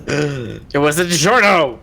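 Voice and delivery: loud mock horror exclamation; mock dramatic reveal. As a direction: shout it like a shock twist.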